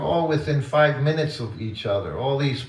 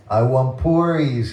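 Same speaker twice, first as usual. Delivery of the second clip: slow, raised, louder plea for puris; insistent child; 4 semitones higher, 6 dB louder than usual. Retold or imitated. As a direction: ask again, digging in like a boy. Child repeating request second time.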